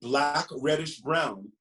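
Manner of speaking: lively, sing-song picture book reading; reading to children. Acted. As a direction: read it like story time for little ones.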